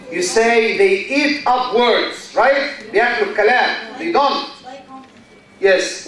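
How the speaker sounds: ordinary delivery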